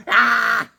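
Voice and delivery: loud, high yell of "Ah!"; hammed-up war cry; mock battle cry. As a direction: shout like you're charging in. Acting the fight.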